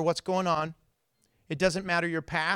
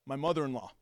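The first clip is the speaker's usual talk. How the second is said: lower, softer, with a laugh; deadpan punchline; 4 semitones lower, 5 dB quieter, faster than usual. Retold or imitated. Owner explains calmly who was in the car, a chuckling aside. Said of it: "he goes".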